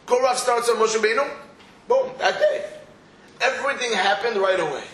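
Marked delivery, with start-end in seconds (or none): 1.9-2.7 excited exclamation. Acted. Dramatic story punchline.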